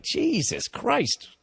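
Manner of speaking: high, slow, drawn-out exclamation; appalled disbelief. Retold or imitated. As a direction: act stunned at going way too far. Imagined shocked reply to a remark.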